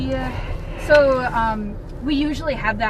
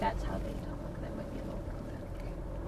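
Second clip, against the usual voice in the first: hushed; 14 semitones lower, 14 dB quieter than usual. Real, not acted. Uneasy aside about offensive remark.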